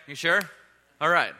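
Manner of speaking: ordinary talk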